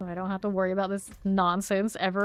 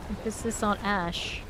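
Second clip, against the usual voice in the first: ordinary delivery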